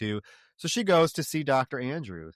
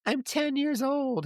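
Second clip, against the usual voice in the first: put-on much higher, slower, a small child's voice; little girl voice; 13 semitones higher than usual. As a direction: play the hypnotized woman as a ten-year-old girl. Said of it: "now she's talking like a little girl".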